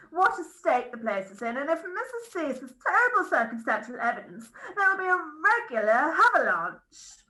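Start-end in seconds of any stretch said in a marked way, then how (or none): none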